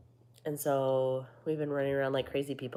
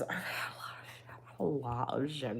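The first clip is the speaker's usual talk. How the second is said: whisper; 5 dB quieter than usual. Real, not acted. Confiding aside.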